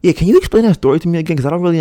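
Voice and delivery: high, loud, fast retold demand; insistent questioning. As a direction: act out pressing someone for an explanation. Acts out confronting the neighbour.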